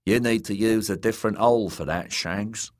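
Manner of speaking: lightly voiced Dornish drawl; casual Dornish-accented jibe. A narrator's reading. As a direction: tease a comrade in a Dornish accent. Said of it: "in his Dornish drawl".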